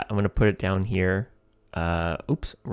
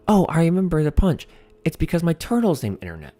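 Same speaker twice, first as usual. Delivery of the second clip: much higher, bright sudden recall, then rushing the punchline; excited comic voice; 7 semitones higher, faster than usual. Acted. Comic on stage remembering the punchline, voiced high and eager. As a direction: blurt it out as if it just came back to you.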